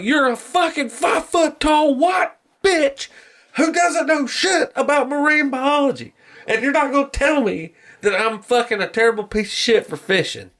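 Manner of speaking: high-pitched, worked-up mock tirade; retold angry rant. Fisherman telling off the activist, acted angry rant.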